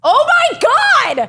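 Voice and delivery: real shocked delight, drawn out high; high excited squeal. Real, not acted. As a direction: squeal it slow and high, stretched out. Reacting to serving [name].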